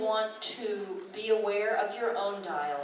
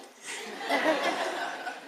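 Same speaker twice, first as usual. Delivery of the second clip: laugh; 7 semitones higher than usual. Real, not acted. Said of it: "smiling so hard".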